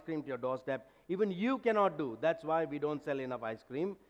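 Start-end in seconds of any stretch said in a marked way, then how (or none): none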